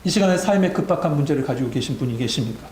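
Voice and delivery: usual speaking voice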